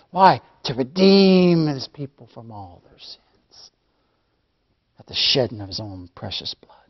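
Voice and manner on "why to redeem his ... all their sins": ordinary talk, nothing marked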